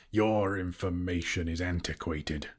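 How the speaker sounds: ordinary delivery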